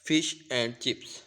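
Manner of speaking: fish and chips said slowly, every word given full stress; careful full-form demo; slow, each word stressed. Shown as a demonstration. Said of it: "If we say this slowly and stress each word".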